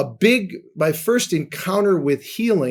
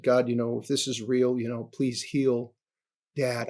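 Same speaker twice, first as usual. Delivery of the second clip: softer, pleading prayer voice; hushed prayer; 3 semitones lower, 7 dB quieter than usual. Retold or imitated. Teenage son praying for his father, hushed plea. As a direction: murmur it like a boy praying in bed. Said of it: "I, I said".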